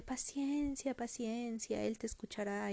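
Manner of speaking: softly voiced patient granny; soothing grandmother. Grandmother reassures Heidi about prayer, soft and patient. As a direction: soothe a child, quietly. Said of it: "la abuelita es de".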